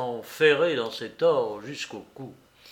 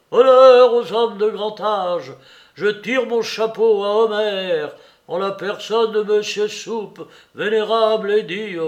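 loud, high mock-solemn proclamation; solemn shouting; 6 semitones higher, 10 dB louder than usual. Letondu cries mock praise for Soupe through the wall. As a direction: declaim like a grand tribute. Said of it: "solennel, criait à travers la muraille".

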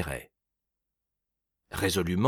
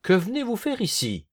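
insolent shout; 9 semitones higher than usual. A narrator's reading. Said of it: "la voix insolente, cria".

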